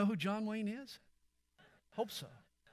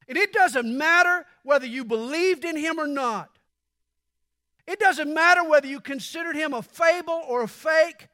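louder, higher, urgent sermon voice; rising pulpit fervour; forceful preaching; 6 semitones higher, 8 dB louder than usual. Acted. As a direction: preach it to the whole room.

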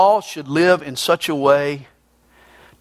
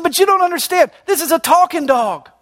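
pleading dog owner; 13 semitones higher, 3 dB louder than usual. Acted.

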